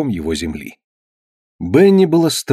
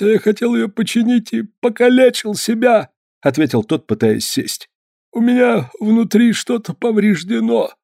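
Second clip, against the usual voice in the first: high, strained voice of a hurt man; injured peddler; 11 semitones higher than usual. Acted. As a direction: voice a frozen, injured peddler in pain.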